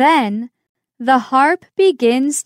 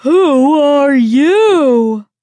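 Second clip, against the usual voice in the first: slow, loud booming giantess; giant woman's call; 4 semitones higher, 6 dB louder, slower than usual. Acted. Giant woman finding Jack in her house, booming. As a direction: boom the question down at tiny Jack.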